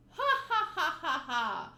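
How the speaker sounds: loud, slow voiced ha ha ha; carefree hero laughing heartily; big laugh. Luffy laughs at the outburst.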